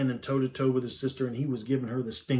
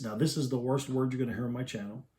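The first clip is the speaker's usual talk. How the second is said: usual speaking voice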